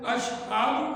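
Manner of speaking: اشہاد said slowly with a long drawn second vowel; اشہاد with the vowel wrongly lengthened. Shown as a demonstration. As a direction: stretch اشہاد like a careless muezzin. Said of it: "اشہد کی جگہ اشہاد کہا".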